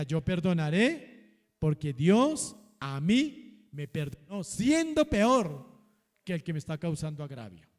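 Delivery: slow, deliberate words for the church to echo; slow declaration to repeat. Acted. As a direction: dictate it slowly for all to repeat. Leads congregation in repeated declaration. (declaración lenta para repetir)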